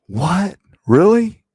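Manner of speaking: loud, high incredulous gasp; surprised. Acted. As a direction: feign total shock. Cop acting shocked at news.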